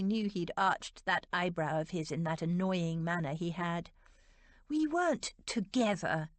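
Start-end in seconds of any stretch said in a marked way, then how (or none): 4.7-6.3 indignant denial. A narrator's reading. Georgiana protests to the Duke, mildly indignant. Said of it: "she said indignantly".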